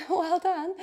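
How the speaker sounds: laughing through the words. Real, not acted. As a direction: say it while cracking up. Laughing during hard press ups.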